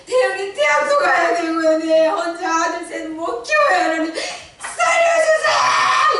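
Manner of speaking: high and loud, breaking into sobs, ending in a shout; weeping wife pleading that she can't raise three sons alone; crying desperate plea. Retold or imitated. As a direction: beg God through tears, building to a scream. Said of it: "절박하게 정말로 간절한 심정으로".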